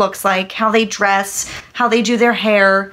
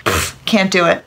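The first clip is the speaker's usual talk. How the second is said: ordinary delivery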